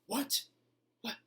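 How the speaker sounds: sudden, high, loud snap of offended surprise; knee-jerk reaction. Shown as a demonstration. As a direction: fire back instantly as if stung by the insult. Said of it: "That knee-jerk reaction".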